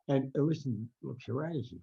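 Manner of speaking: ordinary talk, nothing marked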